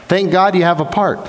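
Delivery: normal talking voice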